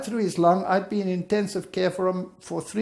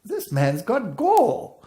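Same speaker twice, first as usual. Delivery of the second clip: slow disbelief; 5 semitones higher, 3 dB louder, much slower than usual. Retold or imitated. I look at my wife.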